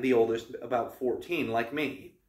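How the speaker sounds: plain reading voice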